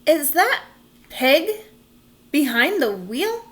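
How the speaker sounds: can't believe his eyes; stunned disbelief; astonished. Acted. Officer astonished at pig driving.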